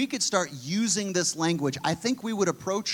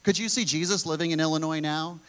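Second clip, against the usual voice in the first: ordinary talk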